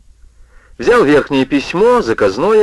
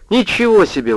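indignant; 9 semitones higher than usual. A narrator's reading. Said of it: "возмутился Орсон".

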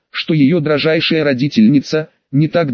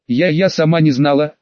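ordinary delivery, nothing marked

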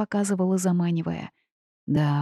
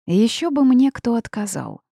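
mild grumble, louder and higher; grumbling; 5 semitones higher, 5 dB louder than usual. A narrator's reading. Heroine retorting to her guide's advice, grumbling. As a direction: grumble back with some force. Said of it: "проворчала я".

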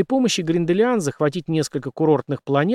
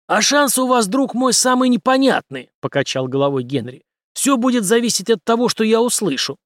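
high, loud, assertive commander; confident interrogator; 9 semitones higher, 4 dB louder than usual. A narrator's reading. Henry weighing up the major's chances, assertive. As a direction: press the prisoner with raised, punchy delivery.

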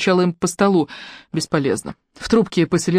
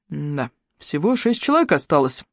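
ordinary delivery, nothing marked

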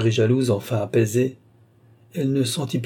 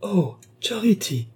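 high, drawn-out cry of dismay; 9 semitones higher, slower than usual. A narrator's reading. Ali sees Charity tear the blouse, crying out in dismay. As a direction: exclaim slowly, high and aghast. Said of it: "consterné".

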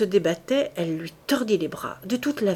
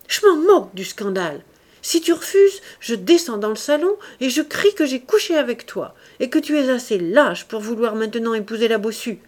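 high, loud defiant threat; menace furieuse; 5 semitones higher, 6 dB louder than usual. A narrator's reading. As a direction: threaten a scandal, voice raised. Acted quarrel, she threatens scandal. (menace lancée d'une voix haute et forte)

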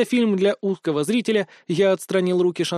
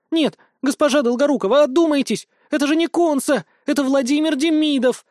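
high, urgent alarmed shout; crying out; 9 semitones higher, 4 dB louder than usual. A narrator's reading. Potion-maker tries to stop her mistress, crying out. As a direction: call out in desperate protest. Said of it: "закричала любительница зелий".

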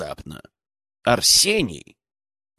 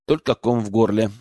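ordinary delivery, nothing marked

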